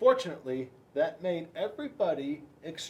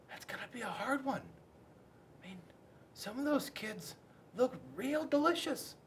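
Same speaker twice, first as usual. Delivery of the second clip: sly whisper; 7 semitones higher, 5 dB quieter than usual. A narrator's reading. Penelope secretly craving kids.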